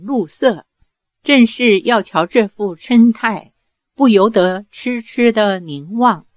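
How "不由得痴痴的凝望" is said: ordinary delivery, nothing marked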